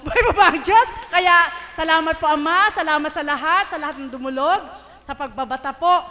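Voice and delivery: fervent shouted thanks; loud closing praise. Real, not acted. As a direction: lift the hall with gratitude.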